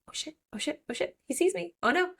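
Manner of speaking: high, hushed "oh shit" panic; mock alarm at being spotted; frantic hushed high-pitched panic; panicked whisper-shriek. Panicked upon seeing the Guardian.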